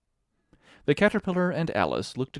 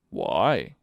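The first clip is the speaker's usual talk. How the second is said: deep curt "why"; 7 semitones lower, 6 dB louder than usual. A narrator's reading. Caterpillar's short question.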